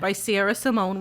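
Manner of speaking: ordinary talk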